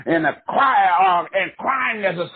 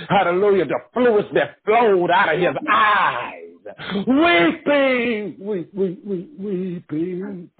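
high, crying preacher's cry, slowed on weeping; impassioned preaching; 4 semitones higher than usual. Acted. Preacher crying out to the church about Christ's weeping. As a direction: wail the word weeping as the sermon peaks.

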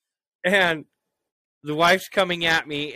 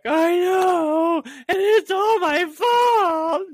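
high, louder mock wailing sob; imitating crying wife; 5 dB louder, much slower than usual. Husband imitating his wife crying it's all her fault. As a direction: wail it high like a sobbing wife. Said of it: "And she's like".

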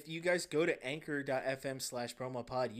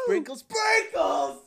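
high, loud, drawn-out mock shout; so hyped; 13 dB louder, much slower than usual. Ice cream worker thrilled by sprinkles, wildly hyped. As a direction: cheer it in hammed-up ecstasy. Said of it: "just so hyped about everything".

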